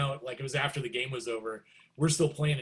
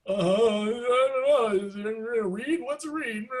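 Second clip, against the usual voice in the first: high, drawn-out panicked babble; mock freak-out; 9 semitones higher, 6 dB louder, slower than usual. Starstruck student asked about his reed by his idol, mock panic. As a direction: starstruck and flustered, hammed up. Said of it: "freaking out".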